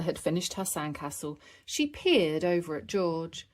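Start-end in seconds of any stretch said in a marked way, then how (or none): none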